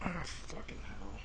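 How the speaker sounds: soft whispered frustration; whispered curse. Real, not acted. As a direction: curse under your breath. Mistake with the ad.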